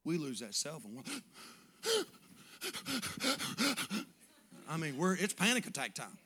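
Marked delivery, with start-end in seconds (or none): 2.5-4.0 mock gasp. Mimicking panic over lost phone.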